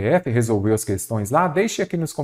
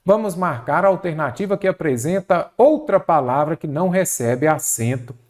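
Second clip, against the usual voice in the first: ordinary delivery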